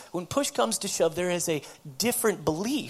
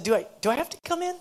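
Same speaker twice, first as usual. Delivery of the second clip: high-pitched, mock reluctant asking; reluctant pleading; 11 semitones higher, 3 dB louder, much faster than usual. Woman asking counselor to skip the appointment, reluctant pleading. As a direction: wheedle to get out of it, pitched up.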